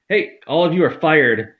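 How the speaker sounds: slow, loud hammed-up firing; mock fury. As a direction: yell it after them, drawn out. Firing his fleeing family.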